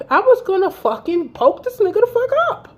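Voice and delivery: high-pitched, heated retelling of rage; retold snapping. Woman tells friends how she nearly snapped, heated. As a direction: push the voice way up in exasperated fury.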